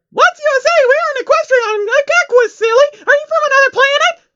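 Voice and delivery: very high, loud, squeaky giggly voice; very high squeaky excited pony voice; bubbly pony. Acted. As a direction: bounce every word like a hyper cartoon pony. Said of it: "Pinky questioned".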